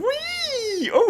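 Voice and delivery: a joyful ride-the-wave squeal; playful whee. Pretend. Watching the hair wiggle, very high playful whee.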